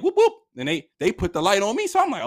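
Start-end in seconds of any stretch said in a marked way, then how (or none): 0.0-0.3 high, loud police siren whoops; imitated siren whoop. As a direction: mimic a quick squad-car whoop. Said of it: "they sped up like".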